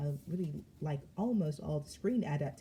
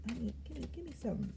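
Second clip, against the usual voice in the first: normal talking voice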